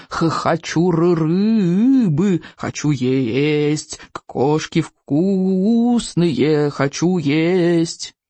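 lightly voiced little dragon, stammering and slow; a small dragon whining for food, understated; mild mock dragon yell. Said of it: "орал".

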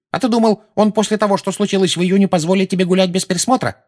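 put-on high, scolding old woman; testy high-pitched reproach; irritated. A narrator's reading. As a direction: lecture the boy with exasperation. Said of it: "раздражённо ответила миссис Фиг".

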